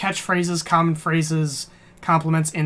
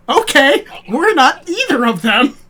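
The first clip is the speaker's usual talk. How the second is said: high, loud mock outrage; hammed-up, high-pitched objection; exasperated protest; 9 semitones higher, 8 dB louder than usual. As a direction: shout back indignantly, playing it up. Answers the mixed-up names.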